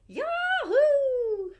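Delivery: very high, loud drawn-out whoop; girl whooping down the hill; gleeful yell. A narrator's reading. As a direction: whoop with delight. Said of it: "Nikki yelled again".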